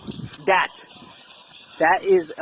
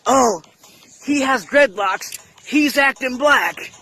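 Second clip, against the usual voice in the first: higher-pitched mocking complaint; mock alarm; 5 semitones higher than usual. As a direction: mimic a scandalized accuser, pitched up. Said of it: "talking about".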